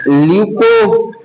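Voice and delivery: slow stretched "leuco-", held apart from the rest; teacher isolating "leuco-" for the class; show the word. Shown as a demonstration. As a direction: break off "leuco-" slowly so students hear the part. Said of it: "ये देखो".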